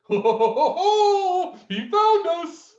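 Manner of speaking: hearty laugh. Acted. Moose agent laughing.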